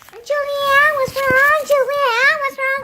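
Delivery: high, loud, pestering mimicry; mocking imitation. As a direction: mimic someone pestering with the same question. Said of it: "You're just like".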